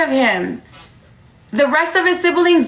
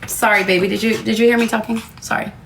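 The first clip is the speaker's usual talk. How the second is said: gentle with daughter; 6 semitones lower, faster than usual. Real, not acted. Mother turns to young daughter.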